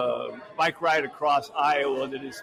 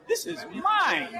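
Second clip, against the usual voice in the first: grand explorer claim; 13 semitones higher, slower than usual. Retold or imitated. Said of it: "ran into Brazil and said".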